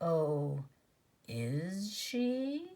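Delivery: a dead hollow voice from the dark; gray terrible voice. A narrator's reading. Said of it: "that gray and terrible voice".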